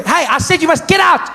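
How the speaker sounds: high, shouted command; angry teacher. Acted. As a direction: shout down the defiant pupil. Teacher insists Jonathan leave.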